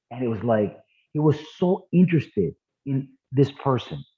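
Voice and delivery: ordinary talk, nothing marked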